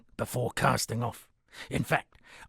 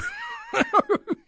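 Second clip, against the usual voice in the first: hearty laugh. Acted. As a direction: laugh out big at your own joke. Hearty laugh after ale joke.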